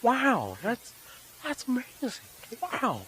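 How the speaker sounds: high drawn-out wow impression; high, wondering wow, a bit hammy; Owen Wilson. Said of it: "I could do an Owen Wilson".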